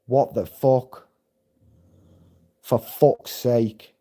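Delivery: slow, understated annoyance; exasperated reaction. Retold or imitated. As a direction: mutedly re-enact being fed up at a nuisance. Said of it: "That was the kind of reaction I had".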